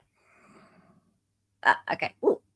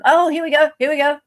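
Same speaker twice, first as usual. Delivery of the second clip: excited; 7 semitones higher, 9 dB louder than usual. Real, not acted. Excited at arriving email.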